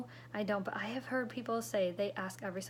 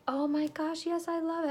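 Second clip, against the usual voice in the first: high, loud burst of delight; gushing customer; 7 semitones higher, 6 dB louder than usual. Retold or imitated. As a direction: gush like a thrilled customer. Delighted customer reply acted out.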